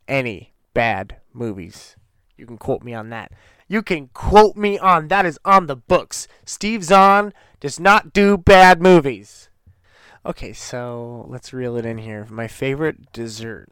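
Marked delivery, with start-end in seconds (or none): 3.7-9.5 much higher and much louder, proclaiming it; emphatic shout. Real, not acted. Fan insisting Steve Zahn never makes bad movies, loud proclaiming shout. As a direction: belt it out like an official declaration.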